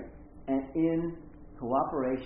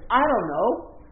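put-on young woman's retort; 12 semitones higher, 8 dB louder than usual. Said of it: "And she says".

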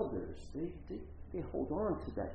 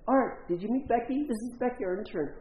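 high, bright-eyed acted introducing; cheery introduction; 10 semitones higher, 4 dB louder than usual. Pastor's colleague introduces the intern to Art, cheery high introduction. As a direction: present her eagerly to the guest.

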